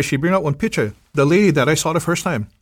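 ordinary delivery

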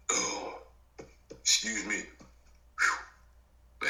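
coughing, then clearing the throat; coughing fit. Real, not acted. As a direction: catch your breath after a cough.

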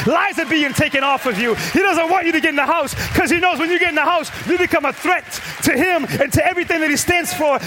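loud, high, driving preacher's cry; preaching at full force. Acted. Preacher urging the congregation to tell God they're angry, preached loud and high. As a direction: lift the room, preach it hard and loud.

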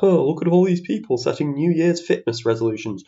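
put-on sneer at the newcomers; mocking imitation. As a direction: imitate a scoffing gym-goer. Mimicking people who mock resolution makers.

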